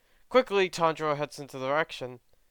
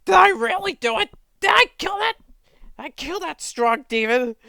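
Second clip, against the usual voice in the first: much higher, shrill-free frantic squeals; hammed-up panic, far higher and louder; panicked disbelief; 14 semitones higher, 8 dB louder than usual. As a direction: gasp it out in terrified shock.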